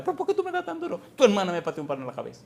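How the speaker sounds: imitating the crying boy Salomón, high-pitched and fast; crying child. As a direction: mimic the skinny kid squealing in protest. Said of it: "empezó a llorar y a gritar".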